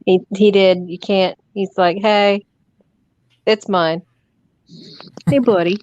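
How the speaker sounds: usual speaking voice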